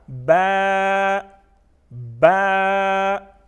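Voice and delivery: बा drawn out with a slight echoing bounce, loud; stretched with qalqala. Shown as a demonstration. Teacher showing pupils how to stretch बा, drawn out. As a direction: pull बा long with a little jolt at the end. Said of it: "इसको थोड़ा सा खींच के पढ़ना है".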